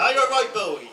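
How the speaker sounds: broad Norfolk greeting, loud; Norfolk phrase. Shown as a demonstration. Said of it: "see if you can say what they are".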